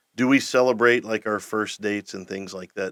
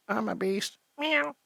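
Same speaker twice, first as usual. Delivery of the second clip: high, soft kitty voice; cute kitten voice; 12 semitones higher, 7 dB quieter, slower than usual. Shown as a demonstration. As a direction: squeak it like a little cat. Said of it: "mine's more like".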